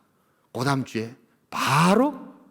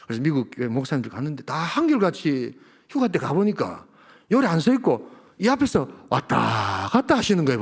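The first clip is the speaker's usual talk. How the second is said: ordinary talk, nothing marked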